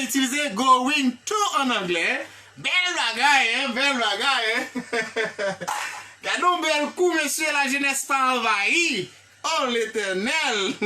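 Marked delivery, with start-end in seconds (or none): none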